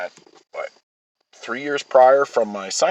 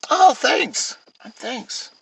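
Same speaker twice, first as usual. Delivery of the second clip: sarcastic thanks; much slower than usual. Retold or imitated. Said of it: "I'm like".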